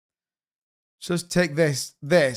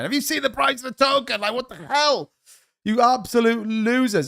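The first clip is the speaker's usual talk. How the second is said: higher, put-on voice, venting at token holders; mock outraged message; 7 semitones higher than usual. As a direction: read the message as a squeaky mocking rant. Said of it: "I was actually messaging these people going".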